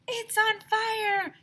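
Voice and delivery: high, loud, slow panicked cry; panicked kid shout. A narrator's reading. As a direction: yell it in alarm. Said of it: "shouted Ryan".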